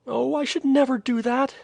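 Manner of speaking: put-on girl protests to her brother, exclaiming. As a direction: burst out in protest. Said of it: "Mysa exclaimed".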